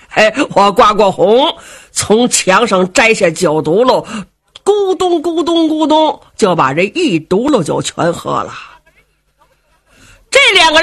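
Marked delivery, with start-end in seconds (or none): 4.6-6.2 high mock gulping noises; gulping sound. Storyteller imitating Xiong gulping the whole gourd, high gulping.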